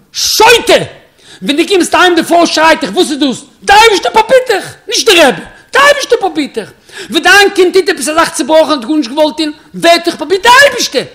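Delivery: high, full-voiced shouting at a foolish pupil; thundering rebuke. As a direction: roar the lesson at him, loud and high. Rebbe erupts, rebuking the chassid. (הויך געשריגענע מוסר)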